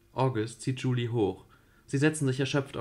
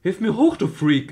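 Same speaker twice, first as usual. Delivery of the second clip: loud, much higher scolding; furious shout; 8 semitones higher, 10 dB louder than usual. Acted. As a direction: yell at him from below. Julie dangling yells at him. (wütendes Anschreien)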